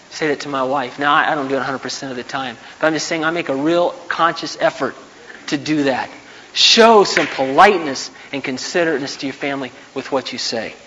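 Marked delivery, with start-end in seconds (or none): none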